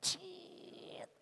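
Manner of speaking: high, thin, quiet "ciiit"; squeaky seat noise. Retold or imitated. Preacher imitates business-class seat reclining, squeaky noise.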